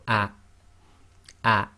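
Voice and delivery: ah cut short with a glottal stop; ah said briefly and stopped in the throat; clipped ah. Shown as a demonstration. Teacher repeats the short hamza vowel. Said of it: "it's almost half the sound".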